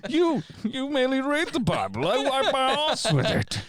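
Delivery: high, strained villain impression; hammed-up mock-villain taunt; Bane. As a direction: boast like a theatrical villain. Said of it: "Has Bane got a job".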